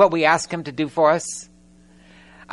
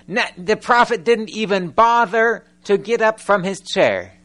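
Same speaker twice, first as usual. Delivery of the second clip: ordinary talk, nothing marked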